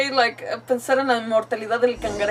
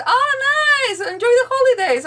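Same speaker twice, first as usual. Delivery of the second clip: high, loud and cheery; bubbly, pitched-up enthusiasm; delighted exclamation; 13 semitones higher, 7 dB louder than usual. Real, not acted. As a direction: send someone off with gleeful good wishes. Delighted at a viewer's holiday.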